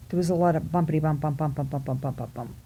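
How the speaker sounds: quick bouncing patter that fades away; bumpy-ride imitation. Telling of a bumpy ride downhill, quick fading patter.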